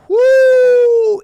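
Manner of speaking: high, loud sustained whoop. Real, not acted. Host hyping the anniversary warning, loud high whoop. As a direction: let out a big whoop.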